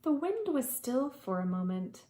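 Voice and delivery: ordinary delivery, nothing marked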